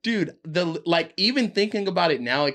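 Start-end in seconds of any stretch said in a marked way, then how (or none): 0.0-0.4 panicked. Retold or imitated. Panicking high on one puff.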